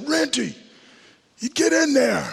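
put-on Dick Stanfield's Boston accent. Coach sends rookie lineman into the game, imitated Boston-accented yell. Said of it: "he yelled my name out, and he said".

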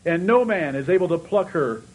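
high, loud, ringing preacher's voice; impassioned sermon delivery quoting Jesus's promise; full-force preaching. Real, not acted. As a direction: preach it out, building with fervour.

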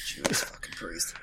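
stunned unvoiced whisper; whispered curse. Real, not acted. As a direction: whisper it in disbelief. Shocked at episode length.

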